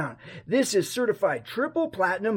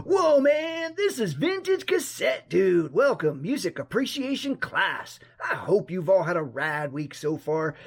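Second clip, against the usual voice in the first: surfer voice. Acted. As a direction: greet the class like a chill surfer. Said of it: "my surfer voice going".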